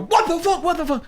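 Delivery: high-pitched baffled squawk; mock bewildered outcry; imitating startled passersby. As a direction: mimic startled strangers, voice jumping up. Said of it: "And they would go".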